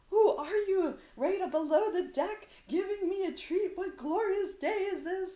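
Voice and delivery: high, loud, awed dog voice; delighted dog. Pretend. The dog Sparty thrilled by the FedEx man's treat, high and loud. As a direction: marvel at the treat like it's a miracle. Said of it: "Sparty was like".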